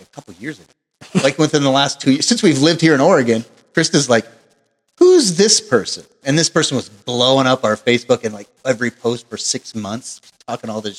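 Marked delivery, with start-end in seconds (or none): 5.0-6.0 mock alarmed question. Krista confronting about stalker.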